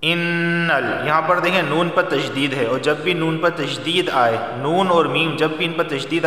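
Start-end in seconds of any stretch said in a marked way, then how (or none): 0.0-1.1 long nasal hum held on the nn; ghunna on noon in innal. Shown as a demonstration. Teacher showing students the rule, ghunna held on noon. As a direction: hold the doubled noon of innal slowly. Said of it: "मैं यहाँ पर गुन्ना कर रहा हूँ".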